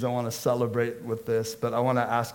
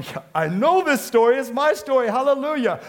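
higher, louder, bursting with joy; joyful congregant; 9 semitones higher, 5 dB louder than usual. Acted. Believer recognizing their own story, joyful. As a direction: cheer like a believer who knows it.

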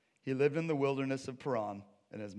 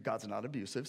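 normal talking voice

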